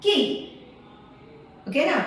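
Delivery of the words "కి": కి said short, "ki"; short vowel demo. Shown as a demonstration. Teacher showing how to pronounce, short కి. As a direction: keep the vowel of కి brief. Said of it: "పలికే విధానం చూడండి".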